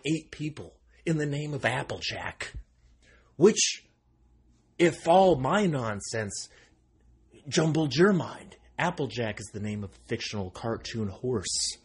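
ordinary talk, nothing marked

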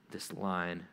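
sigh, then low, soft mock complaint; grumbling. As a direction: act fed up, grumbling under the breath.

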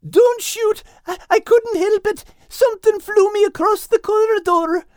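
very high, frantic terrified cry; very high, loud panicked squeal; panicked scream. Acted. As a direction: shriek it, scared of being shot. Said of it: "Al screamed".

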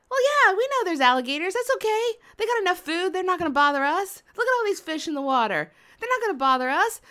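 high, quick, unbothered reassurance; breezy dismissal. Retold or imitated. Canoe friends wave away alligator fears, breezy dismissal.

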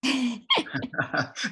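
a real amused laugh, high and brief; short laugh. Real, not acted. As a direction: laugh lightly at the silence. Awkward pause after panel question.

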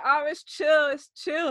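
high, loud laid-back voice; casual Santa guy. Retold or imitated. Santa lying there unbothered.

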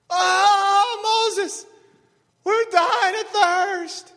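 high, loud, slow whining wail; whiny screaming people. Retold or imitated. Israelites wailing at Moses, high mock whine. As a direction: wail like thirsty complainers.

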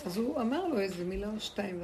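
usual speaking voice

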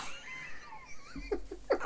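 high, shrieking witch cackle. Acted. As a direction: let out a wild shriek laugh. NPC shrieks after falling.

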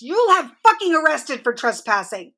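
high, angry threat; furious threat. A narrator's reading. Mother threatening police with arrest, high angry voice. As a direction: threaten them though the words jumble.

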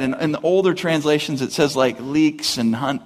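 ordinary talk, nothing marked

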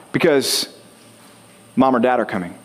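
ordinary talk, nothing marked

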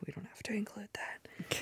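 whispered aside. Real, not acted. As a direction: drop to a secretive whisper. Aside off the record.

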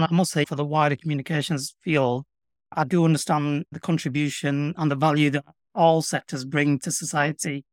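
ordinary delivery

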